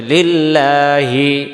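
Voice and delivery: লিল্লাহি with a long held "laa"; slow stretched recitation. Shown as a demonstration. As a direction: chant লিল্লাহি unhurriedly. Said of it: "মানে ধীরে করতে হবে".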